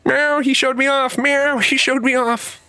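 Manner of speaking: high, meowing cat complaint; whiny cat voice. Retold or imitated. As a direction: wail like a tattling cat. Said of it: "crying at her".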